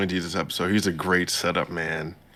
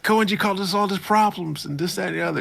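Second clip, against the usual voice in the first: high mock complaining voice; 11 semitones higher than usual. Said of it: "he's like".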